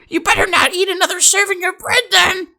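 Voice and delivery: very high mock grandma voice; hammed-up high-pitched warning; scolding grandma imitation. Grandma warns cousin off bread, high mock scolding.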